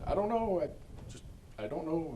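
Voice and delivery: high, tearful, choked-up words; crying. Retold or imitated. To his girlfriend at the shower curtain, crying high and tearful. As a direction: break down and barely get the words out. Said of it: "and kept crying".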